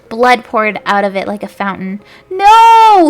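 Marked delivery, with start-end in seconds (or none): 2.3-3.1 very high, loud scream. A narrator's reading. Stabbed villain screaming no, very high loud scream. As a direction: scream as you die. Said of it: "he screamed".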